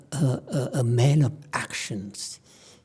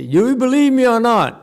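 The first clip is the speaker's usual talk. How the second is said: challenging master; 9 semitones higher, 5 dB louder than usual. Retold or imitated.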